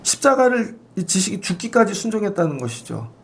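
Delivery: usual speaking voice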